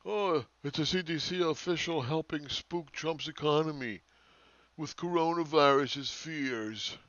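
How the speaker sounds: slow, sneering read-out of a critic's comment; mocking read. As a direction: drag the words out to ridicule them. Reads critic's Facebook comment mockingly.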